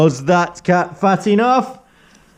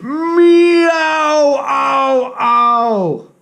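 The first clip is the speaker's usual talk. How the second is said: pained meowing cry; 10 semitones higher, much slower than usual. Acted. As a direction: wail like a stung cat. Stung cat crying out.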